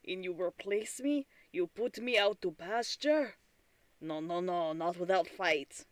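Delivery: indignant computer in a put-on accent; mad PC accent. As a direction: put on an accent, play a wronged, scolding PC. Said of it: "the accent I decided to use for my P C being mad at me".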